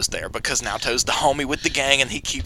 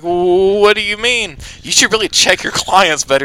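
mock indignant; 6 semitones higher, 7 dB louder than usual. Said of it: "he's like".

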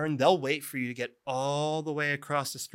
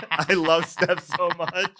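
laughing; 5 semitones higher, 4 dB louder than usual. Real, not acted. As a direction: say it through real laughter. Laughing fondly about a comic friend.

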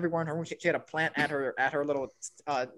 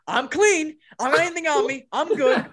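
raised, louder pleading of innocence; panicked protest; 9 semitones higher, 6 dB louder than usual. Retold or imitated. Classmate with hands up before drug officer, imitated panicked protest. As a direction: imitate someone surrendering in a hurry.

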